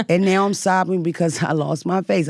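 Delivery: normal talking voice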